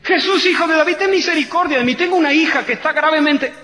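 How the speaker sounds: high, loud pleading cry; desperate crying out. Acted. Mother begging Jesus for her daughter, loud high plea. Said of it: "empieza a decir".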